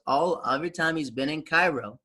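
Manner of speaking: usual speaking voice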